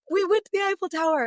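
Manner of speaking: high, loud, cheerful; upbeat self-affirmation; bright affirmation. Retold or imitated. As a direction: chirp it like a mantra. Affirmation to alarm.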